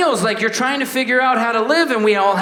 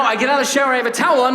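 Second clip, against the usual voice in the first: ordinary talk, nothing marked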